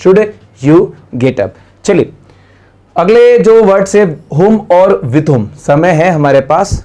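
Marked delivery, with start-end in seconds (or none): 0.0-1.6 slow, deliberate model answer; teacher modelling slowly; slow correct version. Shown as a demonstration. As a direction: spell out the right order slowly. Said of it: "correct होगा".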